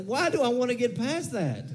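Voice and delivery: incredulous. Acted. Incredulous retort again.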